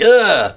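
a sudden high pained grunt; a short high pained "ugh", mock-hurt. Retelling getting tased, a high pained grunt.

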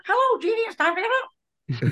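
very high puppet voice; squeaky hand-puppet waking up a child; Kitty K Cat. Retold or imitated. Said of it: "One was Kitty K Cat".

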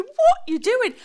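imitating a startled man caught off guard; shocked fiance. Shocked fiance sees her walk into the changing room.

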